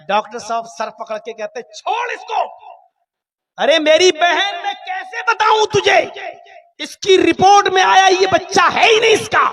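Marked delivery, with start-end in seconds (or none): 1.6-9.5 high, loud exasperated cry; exasperated shout. Acted. Doctor shouting at the woman in exasperation. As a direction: throw up your hands and shout it.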